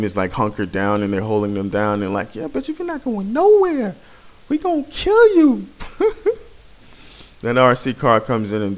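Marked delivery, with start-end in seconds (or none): 2.3-5.7 squeaky high mock taunt at the enemy. Gamer taunting trapped enemies in a squeaky mock voice. 5.7-6.4 squeaky mock cackle; high giggle. Gamer cackling in a squeaky voice after his taunt.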